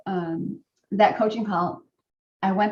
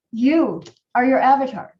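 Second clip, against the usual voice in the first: you punched, louder and higher; emphatic quote; 4 semitones higher, 7 dB louder than usual. Retold or imitated. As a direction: hit the word you hard. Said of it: "and he said".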